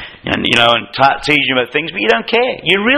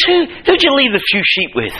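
higher mocking big-brother jab; Eliab's insult; 8 semitones higher than usual. Preacher voicing Eliab mocking David's sheep, higher jab. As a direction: needle him with the question. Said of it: "He says".

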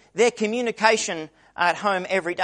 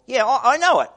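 "Yeah, I, I know it" tossed off higher and a bit louder, like a buddy; casual modern paraphrase; 6 semitones higher, 4 dB louder than usual. Retold or imitated. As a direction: say it like a friend brushing off the news.

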